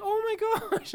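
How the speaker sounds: high, loud retold exclamation; drawn-out high "oh my gosh"; mock emotional gasp. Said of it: "then you're like".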